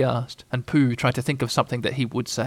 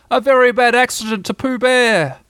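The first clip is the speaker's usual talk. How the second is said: higher, louder test of the voice; loud declaration; 10 semitones higher, 5 dB louder than usual. A narrator's reading. Pooh making sure he can still speak, loudly. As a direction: announce it loudly and deliberately. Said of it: "he said loudly".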